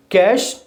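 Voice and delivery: कैश as one syllable, no e; कैश rhymes with cash; correct pronunciation. Shown as a demonstration. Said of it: "wrong है, that is cash".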